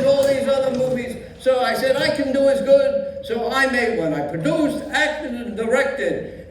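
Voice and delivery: put-on old Yiddish grandpa accent; Yiddish old man voice.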